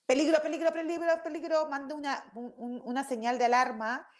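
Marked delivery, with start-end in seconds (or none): 0.1-1.7 alarm cry. Retold or imitated. Teacher voicing the amygdala's alarm, high mock panic. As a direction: sound the alarm like a panicky siren. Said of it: "la amígdala dice".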